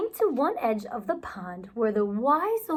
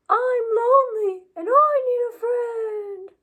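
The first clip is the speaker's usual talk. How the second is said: echoed lonely plea; 9 semitones higher, 4 dB louder than usual. Acted. Echo repeats.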